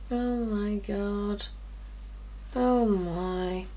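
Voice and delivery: very soft, slow, low amazement; hushed awe. Real, not acted. Vlogger seeing the highlighter on her cheek, hushed awe. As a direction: breathe it out slowly, overawed.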